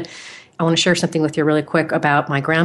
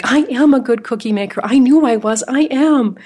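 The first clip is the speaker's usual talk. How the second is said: higher, proud little triumph; victorious; 7 semitones higher, 4 dB louder than usual. Retold or imitated. Proud child boasting after grandma's praise, mildly triumphant and higher. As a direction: crow mildly about your cookies.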